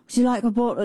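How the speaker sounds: light imitation of an English accent. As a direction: try a hint of English accent. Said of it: "in his accent".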